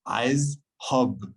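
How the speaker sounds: "Aiz hub" said carefully in Arabic, stress on "hub"; Arabic phrase. Shown as a demonstration. Asked how to say it in Arabic, he pronounces "Aiz hub". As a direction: teach "Aiz hub" slowly, word by word. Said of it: "How do you say it in Arabic?".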